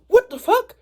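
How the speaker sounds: high, loud startled cry; shocked high exclamation; startled yell. Retold or imitated. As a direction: blurt it out, caught off guard. Seeing Hawks on rooftop.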